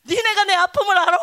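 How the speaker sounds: playful pretend grievance; mock complaint. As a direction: tease them with fake hurt. Mock self-pity to kids.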